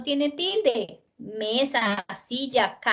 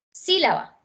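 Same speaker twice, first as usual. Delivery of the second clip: "sílaba" with SÍ higher, then falling away; stress on first syllable; 5 semitones higher than usual. Shown as a demonstration. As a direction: hit the SÍ of "sílaba" high and let the rest drop. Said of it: "Antepenúltima sílaba siempre llevan tilde". ("sílaba" con la primera sílaba aguda y marcada)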